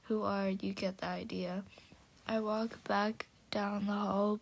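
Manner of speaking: ordinary delivery, nothing marked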